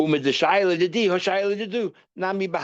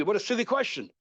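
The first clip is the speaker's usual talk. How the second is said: mild angry tone; 7 semitones higher than usual. Shown as a demonstration. Teacher mimicking his reply to pupil's obvious question, mildly angry. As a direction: act mildly annoyed, pitching it up. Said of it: "then I will get angry".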